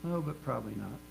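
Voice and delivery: softly muttered doubting aside; muttering under breath. Acted. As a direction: mutter it low-key, as if to yourself. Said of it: "muttering under your breath".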